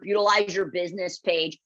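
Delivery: usual speaking voice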